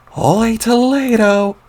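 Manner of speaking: put-on high, slow, loud old-man cry of wonder; loud, drawn-out folksy old-man shout; old-timer exclamation. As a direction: stretch it out like a gobsmacked grandpa. Old grandpa exclaims while powering up.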